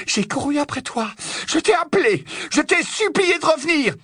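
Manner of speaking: high, shouted reproach building in force; accusation furieuse. Acted. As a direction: hurl it like a curse. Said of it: "C'était comme une malédiction". (reproche crié comme une malédiction)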